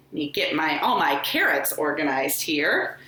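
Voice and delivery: ordinary delivery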